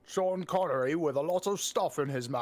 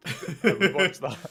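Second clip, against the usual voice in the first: laughing; 7 dB louder than usual. Retold or imitated. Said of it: "I was like".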